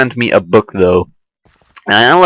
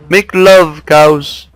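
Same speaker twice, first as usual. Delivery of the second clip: squeaky silly voice; 7 semitones higher, 3 dB louder, much slower than usual. Pretend. Urging the cows to breed.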